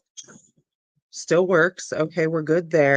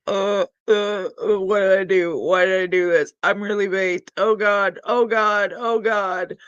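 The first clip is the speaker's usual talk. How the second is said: mock panic; 3 semitones higher, 4 dB louder than usual. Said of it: "I'm just sitting there like".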